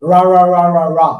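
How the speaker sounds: ra with curled tongue, no palate contact; English r drill. Shown as a demonstration. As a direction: drill ra, tongue never touches the roof. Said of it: "run out". (জিহ্বা না ছুঁইয়ে ra)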